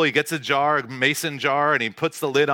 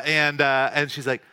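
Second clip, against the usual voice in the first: ordinary talk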